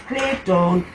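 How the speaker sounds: ordinary delivery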